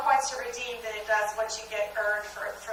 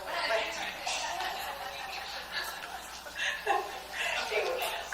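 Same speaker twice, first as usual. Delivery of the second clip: long, helpless real laugh; 4 semitones higher, 9 dB quieter than usual. Real, not acted. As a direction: lose it laughing for several seconds. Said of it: "I'm laughing at your husband".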